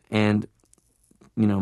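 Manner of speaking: ordinary talk, nothing marked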